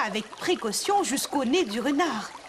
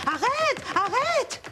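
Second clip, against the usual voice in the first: high, mock shout after the runaway; an old lady calling out, much higher; calling stop; 12 semitones higher, slower than usual. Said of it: "en criant".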